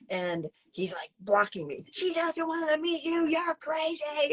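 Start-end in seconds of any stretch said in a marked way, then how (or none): 1.9-4.3 mock him dismissing you. Ex blocking her from the car, mocked sneering.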